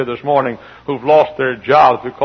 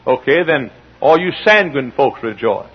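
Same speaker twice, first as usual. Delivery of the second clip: shouted preaching; 11 semitones higher, 9 dB louder than usual. Acted. Preacher to congregation, shouting sermon. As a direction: thunder it at the crowd.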